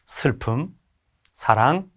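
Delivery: usual speaking voice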